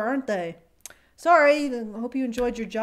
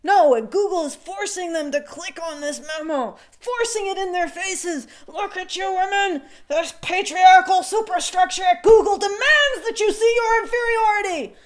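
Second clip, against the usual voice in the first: mock rally speaker; 5 semitones higher, 4 dB louder than usual. Mocking feminist rally speech.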